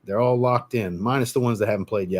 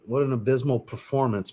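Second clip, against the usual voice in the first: usual speaking voice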